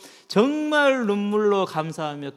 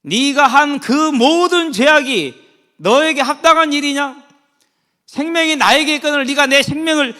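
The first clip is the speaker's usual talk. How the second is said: divine wrath; 8 semitones higher, 8 dB louder than usual. Retold or imitated. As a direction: pour out God's anger at Cain. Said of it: "이 노를 바라시는 거예요".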